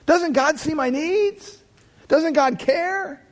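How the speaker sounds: voce più alta e lenta, implorante, come chi si sente dimenticato da Dio; pleading doubt. Pretend. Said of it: "we're tempted to think".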